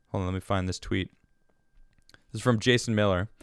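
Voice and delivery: ordinary talk, nothing marked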